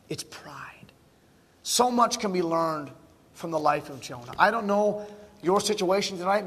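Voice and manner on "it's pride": very soft, grave whisper; hushed. Real, not acted. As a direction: drop to a low whisper. Hushed punchline after the point.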